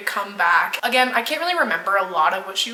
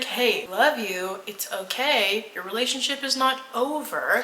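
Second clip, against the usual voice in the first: gentle reassurance; 6 dB quieter than usual. Retold or imitated. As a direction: talk her down gently. Comforting a distraught friend.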